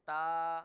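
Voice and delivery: ता drawn out long and loud, the wrong way; wrongly stretched ता. Shown as a demonstration. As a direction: drag out ता to show the mistake. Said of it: "अगर हम इसको खींचेंगे".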